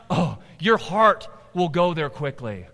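ordinary talk

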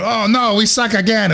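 high, loud mock-dismayed cry; quoting a movie line. Dad and sons quoting a movie together, loud mock dismay. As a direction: wail it like a hammed-up comedy lament. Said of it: "But, but then we go".

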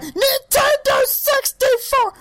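high drawn-out shriek; N64 kid yell. Retold or imitated. Quoting the screaming kid meme to friends. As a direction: stretch it out like the famous screaming kid. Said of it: "I turned into the N sixty-four kid".

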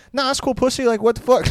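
high, jokey impression of the bar manager; mock bar manager. Imitating the bar manager calling about the shift, high and breezy. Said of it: "He's like".

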